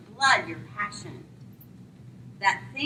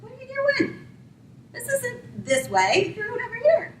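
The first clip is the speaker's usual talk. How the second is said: much higher, incredulous scolding; exasperated rider; 12 semitones higher than usual. Acted. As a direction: scold the donkey in disbelief. Balaam scolds his wandering donkey.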